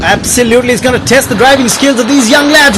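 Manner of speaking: ordinary delivery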